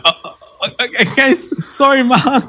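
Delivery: high, rattled, laughing apology; flustered apology. Retold or imitated. Podcaster apologising to Mark after being told to stop, flustered and high. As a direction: caught off guard, squeaking out sorry.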